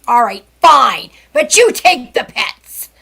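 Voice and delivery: loud, slow, exasperated giving-in; grudging agreement. Retold or imitated. Telling the fireman to take the pets first, loudly grudging. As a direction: give in loudly, stretching each word.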